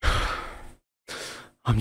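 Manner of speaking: slow, breathless sighing speech; out of breath. Acted. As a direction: sigh through each breathless word. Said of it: "I'm out of breath".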